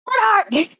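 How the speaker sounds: high-pitched call for someone. Acted. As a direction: call a name across a crowd. Coordinator calls someone over in crowd.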